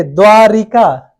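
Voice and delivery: द्वारिका with an extra i, 'dwaa-ri-ka'; wrong pronunciation. Shown as a demonstration. Teacher showing the faulty word, with 'ri' inserted. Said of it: "गलत शब्द है".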